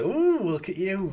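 high, slow, loud flirty coo; flirty tease. Retold or imitated. Eve finds Bond in a towel, slow flirty tease. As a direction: tease him, drawing it out. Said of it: "and she's like".

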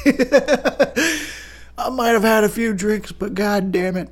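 put-on high, drawn-out drunken insistence; pretend drunk. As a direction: play a tipsy fan defending a hero. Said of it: "gonna be so drunk".

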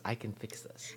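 whispered to herself. Real, not acted. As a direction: breathe it out under the breath. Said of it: "whispering to self".

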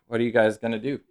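ordinary talk

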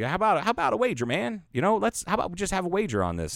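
high, fast smarmy sneer; mock slimy greaseball. Narrator mimicking the slimy rival offering Ted a wager, high and fast. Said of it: "type is just like".

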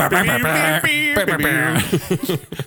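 giggling fit; long high laugh. Real, not acted.

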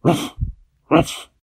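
short barking woofs; dog bark. A narrator's reading. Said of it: "just went".

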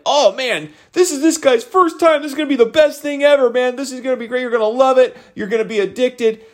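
high-pitched hype from the crowd; high mock gushing, easing down toward the end; gushing enthusiasm. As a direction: imitate excited buddies pumping up a newcomer. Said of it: "lose their enthusiasm for me".

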